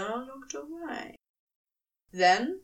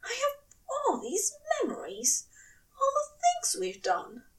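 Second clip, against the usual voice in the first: higher, tearful, speeding at the end; tearful angel; 9 semitones higher than usual. A narrator's reading. Angel pouring out his memories to his lover, tearful. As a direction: let the tears rise as it rushes out.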